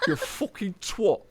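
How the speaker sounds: high, slow Bradford drawl; mock Bradford accent. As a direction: drag out the Bradford insult through laughter. Joins in the Bradford impression.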